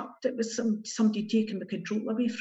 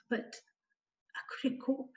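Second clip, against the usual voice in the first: choked whisper; 5 semitones higher, 6 dB quieter than usual. Real, not acted. Breaking down recalling trauma.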